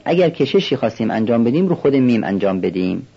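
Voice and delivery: ordinary delivery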